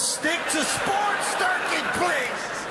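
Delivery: drawn-out high yelled plea, words stretched wide; hammed-up stretched begging shout; slow high plea. Shown as a demonstration. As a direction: beg him slowly at the top of your voice. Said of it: "repeats slow, high, yelled".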